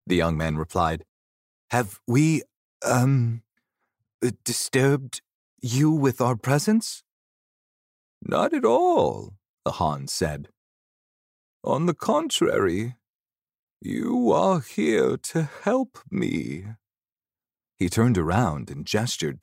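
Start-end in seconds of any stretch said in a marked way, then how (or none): 1.7-7.0 higher, halting question; hesitant, polite. Acted. Young man asking the ghost's pardon, hesitant and polite. 8.2-9.4 put-on high, drawn-out old man's voice. Old ghost reassuring the young man, old man voice. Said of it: "He had the voice of an ordinary old man". 11.6-16.7 put-on high, slow old man's voice. Old ghost telling Seneth he must help, old man voice. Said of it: "He had the voice of an ordinary old man".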